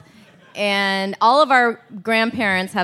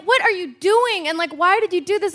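high, retold exasperation; acted distress, pitched well up; upset scolding; 8 semitones higher than usual. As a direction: replay a frantic daughter demanding answers. Upset daughter scolds dad, retold.

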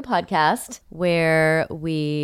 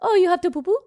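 high, cooing undefined foreign accent; silly vaguely French accent, pitched up; 11 semitones higher, much faster than usual. Shown as a demonstration. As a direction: ask a baby in a vague, high put-on accent. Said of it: "undefined accent".

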